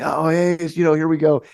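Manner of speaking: half-hearted crowd shout; mild mock yell. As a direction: lightly act out a concertgoer yelling. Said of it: "and yelling".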